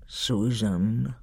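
deep, grave lion voice; Aslan. A narrator's reading. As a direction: say it gently in a deep lion's voice. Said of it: "the deep voice said".

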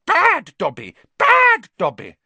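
high, loud self-scolding cries; frantic creature shouting at himself; shouting elf. A narrator's reading. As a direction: punch BAD hard in a squeaky elf voice.

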